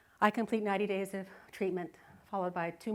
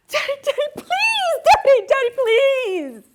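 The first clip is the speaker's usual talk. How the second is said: put-on very high, loud pleading kids' whine; begging children; 15 dB louder, slower than usual. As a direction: beg like little kids desperate for the ride. Kids begging dad for ride.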